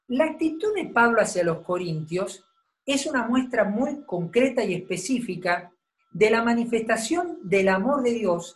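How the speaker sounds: ordinary delivery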